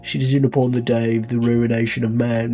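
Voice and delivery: speechify like a British leader on tape; British accent. Acted. Leader welcoming citizens to Un London, in a light British accent. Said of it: "I have to do the accent".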